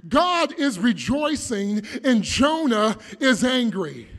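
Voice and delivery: loud, rising sermon climax; full-force preaching. Acted. Preacher driving his point home to the church, full force. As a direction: thunder it out to the congregation.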